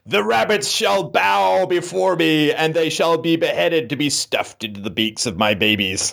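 slow, grandiose villain proclamation; dark lord. Pretend. Sudden villain proclamation, far timbre.

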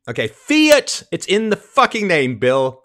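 high, loud furious outburst at Bill; angry shout. Real, not acted. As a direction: yell the point like he can't believe it. Ranting at Bill Maher.

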